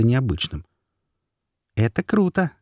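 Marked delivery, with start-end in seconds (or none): 1.7-2.5 pixie. Acted. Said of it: "раздался в моей голове голос феи".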